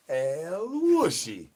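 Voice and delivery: very slow and high, each syllable stretched long; drawn-out El Wushy call. Pretend. Streamer performing the El Wushy call chat asked for, slow and high. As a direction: croon the name out slowly like a summons.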